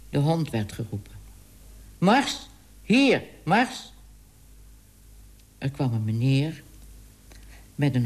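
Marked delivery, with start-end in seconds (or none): 2.0-3.9 calling a dog. Acted. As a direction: yell the dog's name to bring it back.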